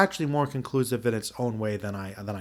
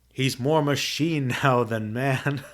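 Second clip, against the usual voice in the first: laughing through the line; slower than usual. Real, not acted. Joking about Archer becoming robot.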